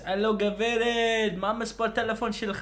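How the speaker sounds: high-pitched mock Israeli pickup line; put-on Israeli accent. Shown as a demonstration. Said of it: "I can do this".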